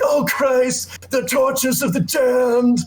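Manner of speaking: high mock wail, drawing out the final words; hammed-up vampire agony, slow and very high; Nicolas Cage impression. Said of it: "he talks in this weird accent throughout the movie".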